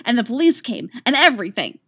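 put-on excited child. A narrator's reading. Katara telling Coach how she fought back, excitedly. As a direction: gush it out like a proud kid.

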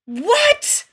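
loud, high shocked yell; shouted. A narrator's reading. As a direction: shout it in outraged disbelief. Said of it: "Rodney shouted".

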